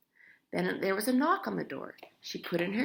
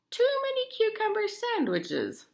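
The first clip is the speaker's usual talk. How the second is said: put-on high, knowing old lady voice; a gran's mock diagnosis, pitched up; hint of a fussy grandma; lightly voiced Grandma. A narrator's reading.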